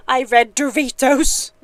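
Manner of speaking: laughing at your own slip; loud, slow words through a laugh. Real, not acted. Cracks up at own misreading.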